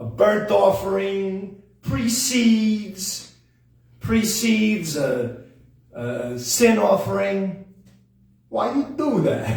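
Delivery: slow, drawn-out comedian impression; Sebastian Maniscalco. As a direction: stretch the words like a stand-up comic. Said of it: "found myself starting to do, like, uh, Sebastian Maniscalco".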